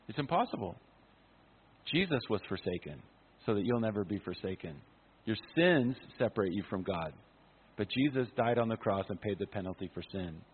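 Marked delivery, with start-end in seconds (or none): none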